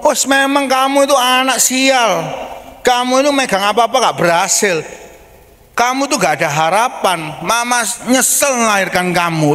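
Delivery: a high, mock harsh parental scolding; imitated angry parent labelling a child. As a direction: lightly play a mother berating her child. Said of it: "gitu loh ngomongnya".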